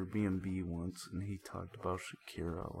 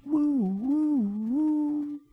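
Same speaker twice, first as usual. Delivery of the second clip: imitated siren; 12 dB louder, much slower than usual. Police siren behind the car.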